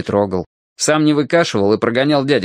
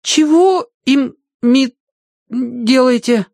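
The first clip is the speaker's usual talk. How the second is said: slow, high-pitched stumble over the word; bewildered boy's high voice; baffled boy; 13 semitones higher, 3 dB louder, much slower than usual. A narrator's reading. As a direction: trip over the unfamiliar word, high and puzzled. Puzzled boy stumbles on long word.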